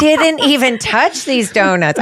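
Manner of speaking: high, slow, drawn-out accusation; mock scolding. Retelling how she told the girls about donuts, mock scolding. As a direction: stretch it out in playful indignation.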